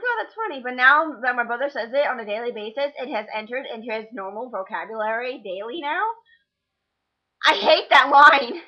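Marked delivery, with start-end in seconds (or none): 0.0-0.6 amused surprise. Retold or imitated. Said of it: "I'm like". 7.5-8.6 loud exasperation. Real, not acted. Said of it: "own exasperation".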